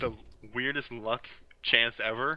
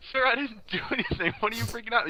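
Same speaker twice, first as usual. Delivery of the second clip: high, frantic pleading NPC voice; mock pleading; 12 semitones higher than usual. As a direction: beg in a hammed-up panicky squeak. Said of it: "It's like".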